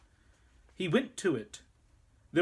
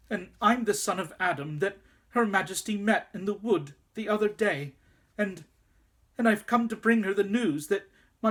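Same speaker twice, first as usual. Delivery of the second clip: higher, hurried and stammering; nervous rush; 6 semitones higher than usual. A narrator's reading. Boy nervously explaining himself to the wolf, rushed nervous stammer. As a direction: blurt it out nervously, tripping over words. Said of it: "trembling so that he could hardly speak".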